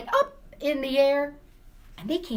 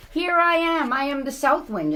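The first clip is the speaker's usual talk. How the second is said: south wind; 6 semitones higher, 6 dB louder than usual. Acted. South wind announces itself.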